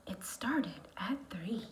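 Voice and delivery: whisper. Acted. Story read in a whisper.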